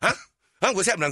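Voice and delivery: mock a startled dad butting in; nosy dad. Dad overhearing his sons' talk, nosy and demanding.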